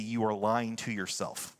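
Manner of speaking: low, quiet and slow, every word landed; a quiet, slow verdict that leaves no way out; blunt. Shown as a demonstration. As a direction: say it flatly to their face. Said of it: "let me say it as bluntly as I can".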